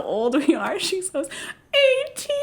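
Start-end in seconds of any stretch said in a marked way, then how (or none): 1.7-2.3 much higher, wailing imitation of crying; mock sobbing answer. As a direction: blurt the age in a teary wail. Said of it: "She just starts crying".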